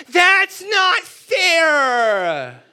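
high, loud, long-drawn tantrum wail; spoiled kid. Acted. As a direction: howl it like a spoiled child. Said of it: "every spoiled American kid has said this".

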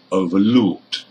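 "overlooked", weight falling on LOOKED; stress on LOOKED. Shown as a demonstration. Teacher repeats the verb for learners, "overlooked" stressed on LOOKED. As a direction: punch the second part of "overlooked", LOOKED. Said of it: "and looked in number two".

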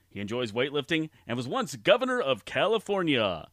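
ordinary talk

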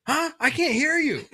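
high-pitched oblivious yell; retold yelling. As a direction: call out high like you can't hear. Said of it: "I'm, like, yelling".

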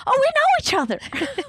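high, loud, over-eager blurt; gushing outburst. Retold or imitated. Retelling her text reply to a match, gushing. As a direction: blurt it out with far too much excitement.

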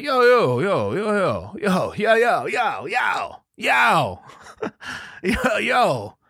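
loud, high, repeated yo to break it up; shouting yo. Retold or imitated. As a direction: jump in yelling to stop it. Stepping in shouting.

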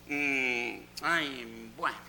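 slow, soft, grudging pasen pues; grudging welcome to unwanted guests; a touch of irritated politeness; half-annoyed. Retold or imitated. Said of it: "como medio timbrada nos dijo".

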